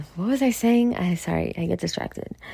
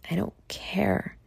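real near-whisper, slow and drawn out; hushed admission; 9 dB quieter, slower than usual. Real, not acted. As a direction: say it slowly under your breath. Weary personal admission, near whisper.

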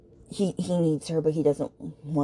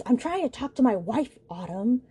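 higher-pitched mock exasperation; retold exasperation; 8 semitones higher than usual. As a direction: imitate an exasperated husband. Retold quarrel, husband snaps at Autumn.

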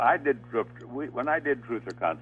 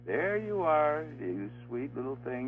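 put-on higher, slower cooing baby talk; imitating a big man's sweet baby talk, higher; 7 semitones higher than usual. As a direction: coo it like soothing a baby, pitched higher. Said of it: "started talking baby talk to me".

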